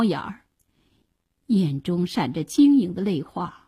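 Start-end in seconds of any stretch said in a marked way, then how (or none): none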